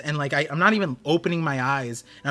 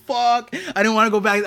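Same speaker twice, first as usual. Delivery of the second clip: higher, louder groan of frustration; frustrated outburst; 6 semitones higher, 5 dB louder than usual. Retold or imitated. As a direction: blurt it out in dismay. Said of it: "I was like".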